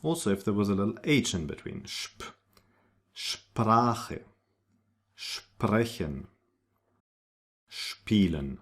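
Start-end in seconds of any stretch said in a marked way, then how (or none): 1.9-2.4 sp hushed to a quiet shp; shp sound. Shown as a demonstration. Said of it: "pronounced like sp". 3.1-8.6 Sprache, sprechen, spielen start with schp; German sp as schp. Shown as a demonstration. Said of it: "pronounced like sp".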